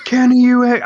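loud, high wrestler promo attempt; Terry Funk. Friend retells a promo cut on him, loud high imitation. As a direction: launch loudly into a wrestler impression. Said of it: "in a Terry Funk voice".

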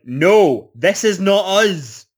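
mock insistence, each word pressed home; imitated protest. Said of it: "they're trying to be like".

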